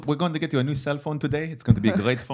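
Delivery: sales pitch in an Israeli accent. Shown as a demonstration. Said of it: "I could do the Israeli accent".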